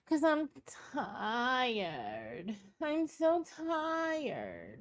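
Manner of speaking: slow, drawn-out whiny moan on "so tired"; mock tired whine. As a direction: mimic a woman who won't stop moaning that she's tired. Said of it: "how tired she is in that voice".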